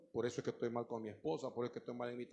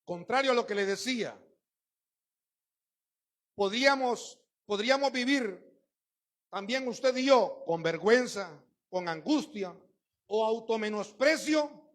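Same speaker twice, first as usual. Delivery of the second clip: louder, higher preaching push; fiery preaching; 7 semitones higher, 10 dB louder than usual. Acted. Pastor exhorting the congregation, fiery. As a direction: drive it home to the church.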